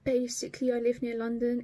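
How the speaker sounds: normal talking voice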